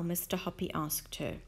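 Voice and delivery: plain reading voice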